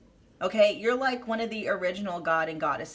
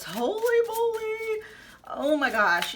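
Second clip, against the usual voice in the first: high, slow, drawn-out holy moly; real stunned delight; amazed; 14 semitones higher, slower than usual. Real, not acted. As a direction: gasp it out in awe. Card reveal amazement.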